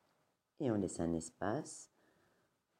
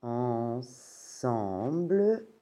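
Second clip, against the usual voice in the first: Ensemble drawn out slowly, each syllable separated; stretched syllables; 3 semitones higher, 4 dB louder, much slower than usual. Shown as a demonstration. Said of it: "slow syllabified model".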